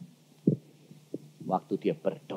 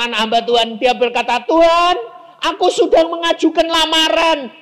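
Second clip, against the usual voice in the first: high, loud impassioned preaching; preaching at full force; 12 dB louder than usual. Acted. Pastor telling the congregation about a job applicant, loud and high. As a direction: drive the example home loudly.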